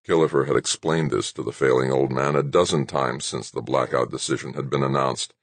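plain reading voice

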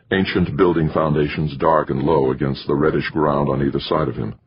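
plain reading voice

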